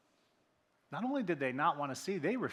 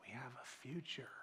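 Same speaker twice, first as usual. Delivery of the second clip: soft unvoiced whisper; whispered hope; 3 semitones lower, 12 dB quieter than usual. Real, not acted. Preacher offering hope to the room, whispered. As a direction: let the promise land in a hush.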